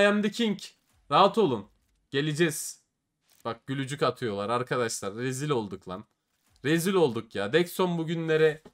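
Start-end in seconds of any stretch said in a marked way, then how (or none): none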